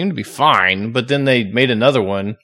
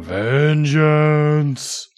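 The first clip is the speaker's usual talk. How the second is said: ghost voice; 2 semitones higher than usual. Retold or imitated. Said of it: "this ghostly voice going".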